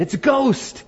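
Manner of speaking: high-pitched terrified exclamation; fearful cry. Retold or imitated. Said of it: "they cried out in fear".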